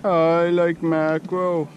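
drawn-out playful chant; lazy singsong line; slow sing-song. Pretend. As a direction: stretch out I like mackerel slowly. Sing-song while fishing.

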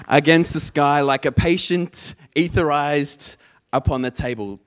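very loud, punched slam recital; slammy beat poetry. Shown as a demonstration. Poet performs T S Eliot for practice clicks, slam poetry style. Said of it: "in a really slammy kind of beat poetry style".

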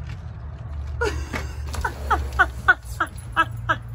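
long high-pitched breathy laugh; squeaky giggles that keep going; high laughing fit. Real, not acted. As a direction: laugh helplessly with relief. Rescued after being locked out.

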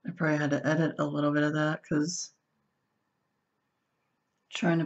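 hands pressed around mouth; muffled behind hands; speaking through cupped hands. Real, not acted. Seen 0: Hands press the sheet mask around her nose and mouth while talking.

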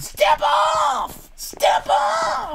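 very high, stretched-out shout; high yell. Real, not acted. Player orders opponent away in game, high yell. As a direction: yell a shrieked warning twice.

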